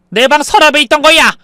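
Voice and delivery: high, loud protest; angry insistence. Acted. Mother insisting bankbook was in drawer, angry protest. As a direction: insist against being doubted.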